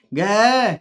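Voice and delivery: घे held long on its final vowel; drawn-out syllable. Shown as a demonstration. Teacher testing pupils on the end sound, घे drawn out. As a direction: stretch घे out evenly. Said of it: "किसका sound है".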